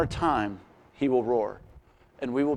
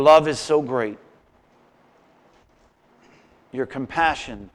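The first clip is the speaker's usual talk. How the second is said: prayer; 7 dB louder than usual. Acted. Leads closing prayer.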